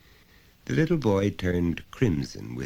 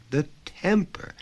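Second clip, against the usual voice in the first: higher, exasperated exclaim; 12 semitones higher than usual. Acted. Mother complaining to friend about son, exasperated. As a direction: throw up your hands at the temper.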